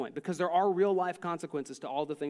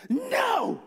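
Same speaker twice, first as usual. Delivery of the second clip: put-on high, loud childish yell; defiant yell; 6 dB louder than usual. Son turning on his mother, defiant yell. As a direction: yell it back in defiance, pitched way up. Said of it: "turns and yells at his mother".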